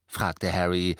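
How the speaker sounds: usual speaking voice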